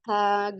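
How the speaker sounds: long held aa in आग, then g; stretched alif madd. Shown as a demonstration. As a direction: draw out the opening vowel of आग. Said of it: "किस तरीके से पढ़ रहे हैं".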